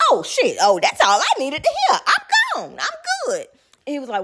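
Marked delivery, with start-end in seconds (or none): 0.6-3.5 much higher, mock-cheery goodbye; squeaky high. Real, not acted. Girlfriend leaving after his confession, squeaky high. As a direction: squeal it out, done with him.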